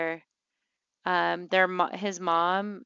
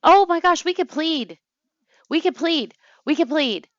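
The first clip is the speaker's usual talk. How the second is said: high, loud sudden burst of an idea; excited outburst; 8 semitones higher, 10 dB louder than usual. Real, not acted. Player suddenly remembers she can plead with death. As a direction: blurt it out, thrilled at the idea.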